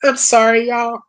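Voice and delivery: apologizing while holding back tears; emotional. Real, not acted. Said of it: "I'm not trying to get emotional".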